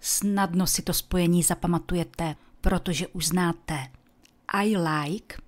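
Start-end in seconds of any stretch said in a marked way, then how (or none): none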